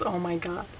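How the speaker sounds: ordinary delivery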